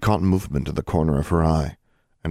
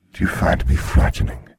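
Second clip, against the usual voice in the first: grim reaper voice; 4 semitones lower, 5 dB louder than usual. A narrator's reading. Death speaks.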